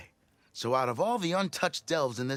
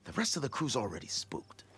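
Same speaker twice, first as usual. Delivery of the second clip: softer, secretive warning; hushed; 6 dB quieter than usual. Acted. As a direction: drop the voice so the crew won't hear. Keep your voice down, will ya?.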